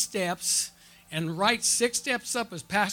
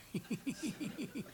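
high, quiet giggling; soft tittering laugh; demonstrated laughter; 6 semitones higher, 15 dB quieter than usual. Shown as a demonstration. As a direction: let out a run of high giggles. Shows his love of laughter.

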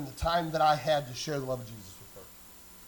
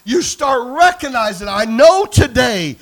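loud, higher, driving sermon delivery; full-force preaching; 4 semitones higher, 15 dB louder than usual. Real, not acted. Preacher urging kingdom people to think eternal, full-force shouting. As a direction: build to a shouted climax and hold it there.

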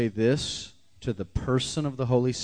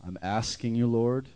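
leading a prayer; slower than usual. Acted. Preacher leading the church in a prayer of repentance. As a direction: pray it aloud over the congregation.

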